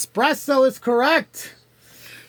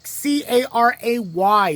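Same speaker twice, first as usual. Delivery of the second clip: normal talking voice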